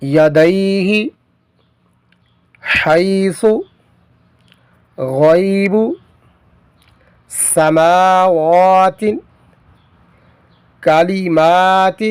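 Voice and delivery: slow, spaced word-by-word recitation. Shown as a demonstration. Teacher reading drilled words fluently for students, measured recitation. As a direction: read each word cleanly with pauses.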